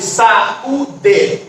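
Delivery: sa, ú, de, slowly apart; saúde split by syllable. Shown as a demonstration. Teacher showing pupils a hiatus, saúde split by syllable. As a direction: break saúde into three slow syllables. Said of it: "porém em sílabas separadas".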